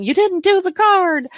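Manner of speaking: high-pitched mock scolding, falling at the end; playful high telling-off; mock yell. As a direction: imitate someone yelling a complaint. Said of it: "Nobody yelled at me".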